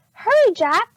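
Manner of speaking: high, loud call; urgent cry. A narrator's reading. Annie urging Jack to run from the storm. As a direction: hurry her brother along. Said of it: "cried Annie".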